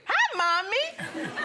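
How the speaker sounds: put-on a light, high, chirpy child's hello; little boy voice. Son greeting mom in the lobby, lightly voiced high child. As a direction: play the cheerful little boy.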